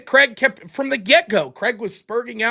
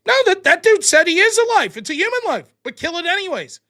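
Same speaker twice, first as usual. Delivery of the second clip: incredulous; 7 semitones higher, 3 dB louder than usual. Real, not acted. Incredulous at donor's point.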